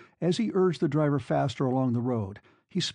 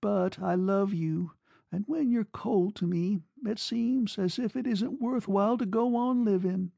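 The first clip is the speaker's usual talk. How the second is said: high-pitched, plaintive declaration; broken murmur; 8 semitones higher than usual. A narrator's reading. Woman telling lover she cannot live without him, broken murmur. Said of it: "she murmured brokenly".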